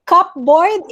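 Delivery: CUPBOARD with the P sounded, cup-board; wrong pronunciation. Shown as a demonstration. Teacher quoting the mistake in a spelling game, cup-board with a P. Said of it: "it's not cupboard, it's cupboard".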